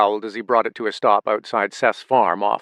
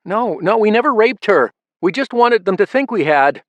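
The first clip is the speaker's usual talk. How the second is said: frightened; 11 semitones higher, 5 dB louder than usual. A narrator's reading. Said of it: "wild and fearful".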